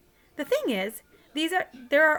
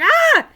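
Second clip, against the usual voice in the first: short high shouted ah, much louder and higher; mock scream; 15 dB louder than usual. Little brother imitating his scream at the park, high loud ah. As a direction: yelp it like a scared little kid. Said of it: "I was like".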